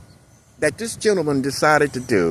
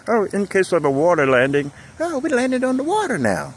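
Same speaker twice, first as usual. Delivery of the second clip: mock announcement; 8 semitones higher than usual. Mock flight attendant panic.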